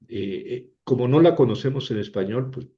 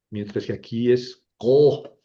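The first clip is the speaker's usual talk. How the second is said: ordinary delivery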